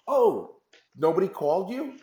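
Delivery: high, startled "Oh, nobody called you?"; mock surprised venue head on the phone; surprised venue manager.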